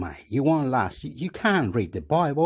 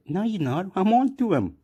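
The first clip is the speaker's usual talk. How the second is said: stern lady; 8 semitones higher than usual. Retold or imitated. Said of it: "she said".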